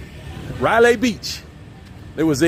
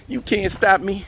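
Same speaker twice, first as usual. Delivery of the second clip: playful boast pitched up high; high-pitched take; 6 semitones higher, slower than usual. Pretend. Vlogger repeats a playful boast on a walk, high-pitched. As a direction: lift the whole boast high in your range.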